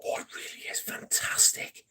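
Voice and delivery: breathy whispered aside; hushed whisper. Real, not acted. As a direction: whisper it as if savouring the scent. Savouring the scent, hushed aside.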